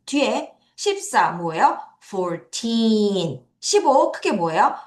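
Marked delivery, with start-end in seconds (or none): 2.0-3.5 put-on Fourteen with a long, strong TEEN; four-TEEEN, ending held; stress on TEEN. Shown as a demonstration. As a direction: stretch the second syllable of Fourteen. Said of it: "강하게 읽어주셔야 돼요".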